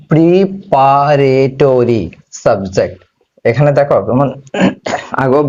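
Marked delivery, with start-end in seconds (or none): 0.1-3.0 slow, drawn-out term. Shown as a demonstration. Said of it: "এরপরটা কি?".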